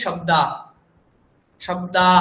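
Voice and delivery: শব্দা ending on an open long আ, no breath after; Bengali pronunciation. Shown as a demonstration. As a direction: say শব্দা as in Bengali, stopping on the vowel. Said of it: "তখন আমরা এটাই কি বলি?". (বাংলা উচ্চারণে শব্দা, শেষে শুধু আ)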